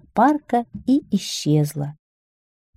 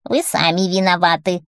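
lightly voiced reproachful witch; blaming old witch voice; hoarse witch. A narrator's reading. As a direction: scold him in a witchy rasp. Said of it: "своим хриплым голосом".

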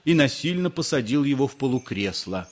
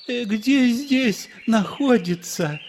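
higher, slow, gentle question; pleasant voice; 8 semitones higher, slower than usual. A narrator's reading. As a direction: ask it kindly. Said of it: "спросил приятным голосом".

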